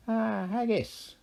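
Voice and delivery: high, sly Irish leprechaun king. A narrator's reading. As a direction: grin through it like a cunning leprechaun. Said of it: "he said".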